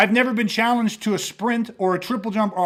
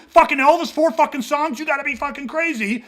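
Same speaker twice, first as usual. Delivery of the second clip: acted-out provocation; 6 semitones higher than usual. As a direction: throw it out like a challenge in a bar quarrel. Acted-out quarrel, spears thrown.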